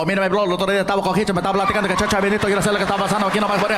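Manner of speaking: fast Puerto Rican Spanish, words tumbling out; rapid-fire Spanish. Retold or imitated. Comic mocking Puerto Rican speakers, rapid-fire Spanish. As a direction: rattle it off like there's a time limit. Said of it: "speak Spanish like there's a time limit".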